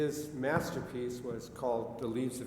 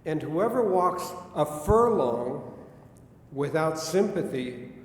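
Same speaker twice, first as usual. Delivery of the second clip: poetry recitation; 5 dB louder than usual. Acted. Reads Whitman poem aloud.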